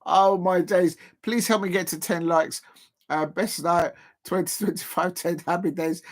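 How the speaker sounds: ordinary talk, nothing marked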